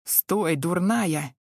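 plain reading voice

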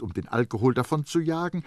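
plain reading voice

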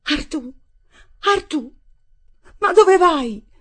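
put-on terrified woman calling after a boy who runs away; frightened shouting. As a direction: shout after him full of fear, the last question highest and loudest. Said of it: "mi gridava".

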